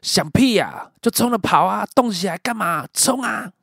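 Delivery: retold angry yelling; roaring. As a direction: roar down the phone to make him run. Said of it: "我直接对电话咆哮".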